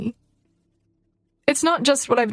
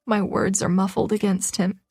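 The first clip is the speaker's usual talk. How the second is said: ordinary delivery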